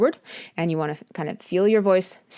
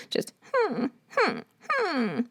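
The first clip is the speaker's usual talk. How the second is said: short high whimpers; little puppy-like whimper; 7 semitones higher, 5 dB quieter, much slower than usual. Shown as a demonstration. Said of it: "that kind of sound".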